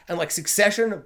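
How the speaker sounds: ordinary talk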